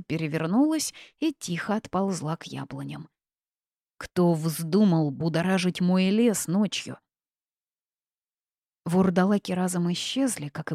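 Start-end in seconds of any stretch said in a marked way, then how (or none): none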